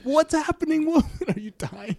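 very high, hammed-up panicked question; mock alarm. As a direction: squeal it in pretend alarm, half laughing. Acted alarm at the scream.

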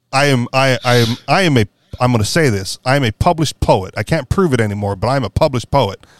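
ordinary talk

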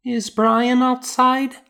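put-on a little girl waking, asking for her brother; child's voice. A narrator's reading. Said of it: "she asked".

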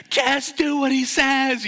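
high loud slow plea; group yelling. Retold or imitated. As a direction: beg him to obey. Crew shouting at swimmer.

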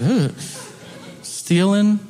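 hesitant questioning answer; timid kid impression. Kid answering the prisoner, hesitant imitation. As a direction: answer haltingly, lightly voiced as a kid. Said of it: "And the kid's like".

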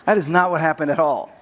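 ordinary talk

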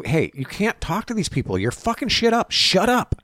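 high-pitched imitation of a scolding handler; mock yelling. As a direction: yell it in an exaggerated high voice. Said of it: "somebody else is gonna yell and go".

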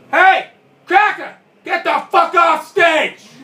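loud, high, slow drawn-out shout; heckler's yell. Retold or imitated. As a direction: bellow like an angry heckler from the crowd. Heckler yells him off stage.

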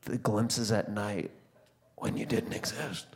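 hushed pleading. Retold or imitated. Begging toddler, hushed.

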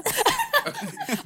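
squealing high laugh; high-pitched laugh. Real, not acted. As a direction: burst out in a shrill giggle. Laughs at her own singing.

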